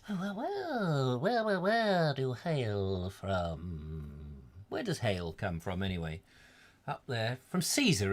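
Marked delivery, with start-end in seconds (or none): 0.1-4.1 slow, drawn-out, exaggerated questioning; most inquiring voice. Host asking a listener's origin, exaggerated inquiring voice. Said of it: "with his most inquiring voice".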